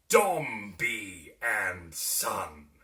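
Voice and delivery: slow, loud, savouring the name; luxurious satisfaction. A narrator's reading. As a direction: relish every word of the firm's name. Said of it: "in exactly the same tone as before".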